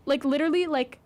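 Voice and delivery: ordinary talk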